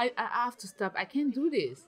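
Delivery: ordinary talk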